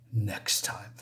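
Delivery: whispered threat, barely audible. Acted. The monster promises to return, ending in a whisper. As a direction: breathe it as a whisper.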